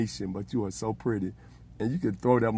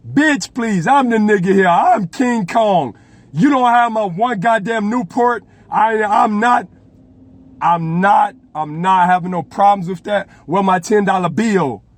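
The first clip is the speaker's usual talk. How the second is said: much higher and louder, blustering; hammed-up Trump rant; 10 semitones higher, 13 dB louder, slower than usual. Trump yelling at his staff for his Newport, high and loud. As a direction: yell like a spoiled king demanding his things.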